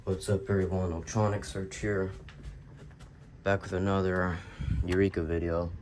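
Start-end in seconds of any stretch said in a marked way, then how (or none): none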